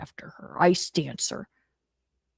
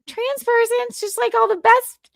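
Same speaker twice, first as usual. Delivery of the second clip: Chrissy Mayer impression; 6 dB louder than usual. Imitating streamer Chrissy with a high, thin voice. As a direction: lift the voice up high as a light female impression. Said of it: "Chrissy Mayer impression".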